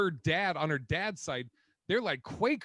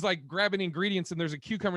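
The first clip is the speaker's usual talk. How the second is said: ordinary talk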